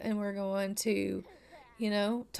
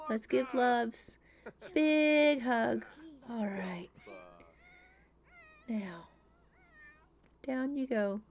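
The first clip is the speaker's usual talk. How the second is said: tender dad; 2 semitones higher, 3 dB quieter, slower than usual. Acted. Dad hugging toddler gently.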